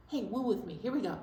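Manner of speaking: usual speaking voice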